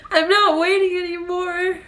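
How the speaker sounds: high, slow words broken by sobs; crying through a laugh; laughing and crying at once; real crying. Real, not acted. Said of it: "I'm crying here".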